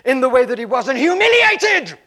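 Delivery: scream against God. Retold or imitated. Ava railing against God in Konya, building to a shout. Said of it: "the same scream against God".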